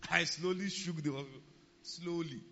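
slow, playful correction; drawn-out slowly. Retold or imitated. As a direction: stretch it out teasingly after a laugh. Joking correction right after laughing.

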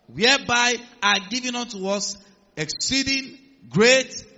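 slow, loud scripture build-up; preaching. Acted. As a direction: build to a shout. Scripture read with rising force.

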